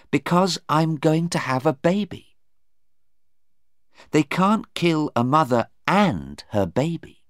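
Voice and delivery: high, emphatic explanation; earnest daughter. Acted. Sarah explaining the law to her mother, earnest.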